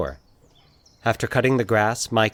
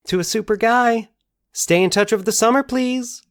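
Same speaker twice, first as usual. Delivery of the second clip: put-on girl's voice; 14 semitones higher, 4 dB louder than usual. A narrator's reading. Reading her yearbook note.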